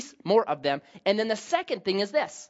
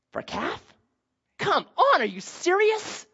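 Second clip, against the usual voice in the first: incredulous; 9 semitones higher than usual. Real, not acted. Mocks trading God for calf.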